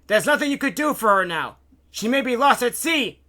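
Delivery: high, loud forceful argument; yelling. A narrator's reading. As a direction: shout down a struggling man. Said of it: "he yelled".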